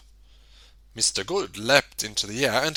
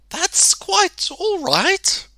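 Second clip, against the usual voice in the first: wondering; much slower than usual. A narrator's reading. Mister Good savours the words.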